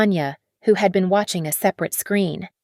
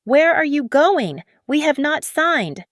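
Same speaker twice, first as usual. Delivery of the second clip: higher, louder enraged yell; furious shouting; 8 semitones higher, 4 dB louder than usual. Acted. Marcus yelling at partners leaving before signing, enraged. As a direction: shout after the deserters in disbelief. Executives abandoning the deal.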